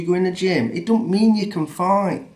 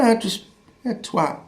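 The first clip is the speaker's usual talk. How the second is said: usual speaking voice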